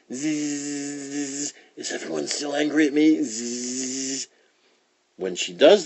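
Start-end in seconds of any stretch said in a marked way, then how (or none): 0.1-1.5 soft, steady high buzz; mosquito whine. Acted. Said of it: "whining in people's ears". 1.8-3.2 quick, higher mosquito whine; whining mosquito. Acted. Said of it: "whining in people's ears". 3.2-4.3 soft, droning insect buzz; mosquito whine. Acted. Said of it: "whining in people's ears".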